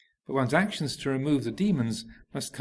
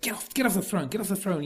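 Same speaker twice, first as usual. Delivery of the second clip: a higher, indignant order off the throne; mildly heated ministers ordering a demon down; upset attendants; 7 semitones higher, faster than usual. Acted. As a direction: shoo the intruder off, affronted. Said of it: "get very upset".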